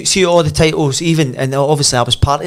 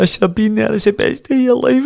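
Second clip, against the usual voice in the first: high-pitched mock tearful wife; imitated crying; 9 semitones higher than usual. Wife on the stairwell regrets missing it, imitated crying. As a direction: lament it in a high, weepy imitation. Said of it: "she started crying".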